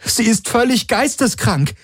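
high, agitated female character's cry; aufgebrachter Ausruf. A narrator's reading. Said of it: "rief Kirai". (aufgebrachter, hoher Ausruf)

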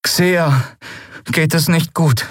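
high, subdued bad news; deeply troubled. A narrator's reading. Miko reporting that Xea is unwell, dejected high voice. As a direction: break the news unhappily. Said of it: "Miko klang tief bedrückt".

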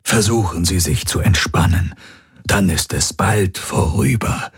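calm doctor. A narrator's reading. Doctor calming woman in labour.

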